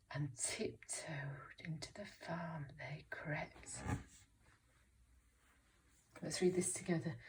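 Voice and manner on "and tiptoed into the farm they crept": slow, very soft whisper; hushed tiptoe voice. A narrator's reading. Teacher reads of thieves creeping into the farm, hushed. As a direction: creep through the words in a whisper. Story burglars creep in at night.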